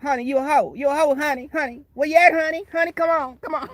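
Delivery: high, loud, sassy taunting; Tracy parody. Retold or imitated. Parody of Tracy calling out her rival, sassy and high. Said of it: "how Tracy be talking".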